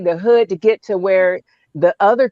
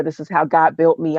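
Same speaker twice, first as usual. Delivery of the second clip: normal talking voice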